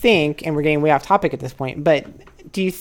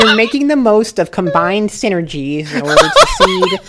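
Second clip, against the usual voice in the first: laughing mockery; 6 semitones higher, 9 dB louder than usual. Real, not acted. Mocking guest's marketing jargon.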